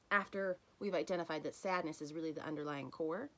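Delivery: ordinary delivery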